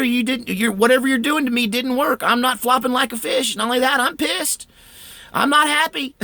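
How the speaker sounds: angry high-pitched shouting at the room; retold furious scream. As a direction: yell it out at unseen attackers. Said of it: "I screamed out to the room".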